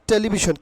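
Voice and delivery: "টেলিভিশন" said te-li-bi-shon, plain t and b; the wrong "টেলিভিশন", unpuffed t, "sh" in the end; common mispronunciation. Shown as a demonstration. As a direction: say "টেলিভিশন" quickly joined as telibishon.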